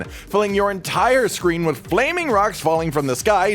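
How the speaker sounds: normal talking voice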